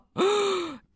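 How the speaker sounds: high dramatic gasp; mock shocked gasp. Reacting to Nix accusing Mare, theatrical gasp. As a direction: suck in air in pretend shock.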